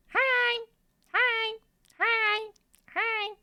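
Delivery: very high, chirpy greeting; high-pitched playful hello; squeaky hi. Pretend. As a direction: squeak each hi like a cartoon.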